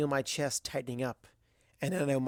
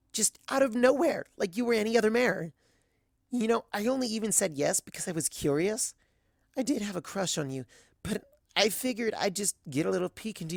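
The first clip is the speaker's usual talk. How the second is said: put-on higher, earnest young mare; gentle mare voice; 7 semitones higher, 3 dB louder than usual. A narrator's reading. Azalea voiced by narrator.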